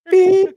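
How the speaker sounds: robot beep. Retold or imitated. Sputnik can only beep.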